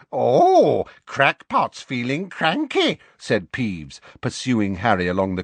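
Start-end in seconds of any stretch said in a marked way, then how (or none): 0.1-3.0 much higher, slow mocking sprite voice; sing-song teasing as the gleeful poltergeist; taunting poltergeist. A narrator's reading. Peeves taunts Harry.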